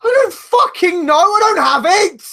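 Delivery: high, loud protest; exasperated yelling. Real, not acted. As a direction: shout back that you have no idea. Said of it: "hear you guys yelling at each other".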